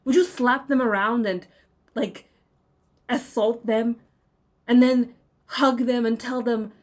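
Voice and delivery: loud, furious harangue; furious shouting. Real, not acted. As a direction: shout down the believers. Angry rant to viewers.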